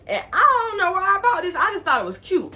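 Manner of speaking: high, loud giddy confession; excited. Real, not acted. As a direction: gush about the cute purchase. Excited about fries toy.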